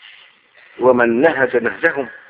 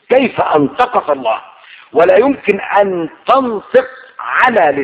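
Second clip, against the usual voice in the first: raised, fervent sermon voice, pressing each phrase; impassioned preaching; 6 semitones higher, 4 dB louder than usual. Acted. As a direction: preach it hard, swearing by the Lord. Preaching at full force. (خطابة حماسية بصوت مرتفع)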